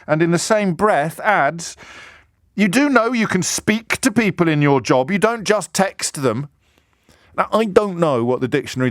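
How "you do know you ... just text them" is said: lecturing. Retold or imitated. Gavin's text read again.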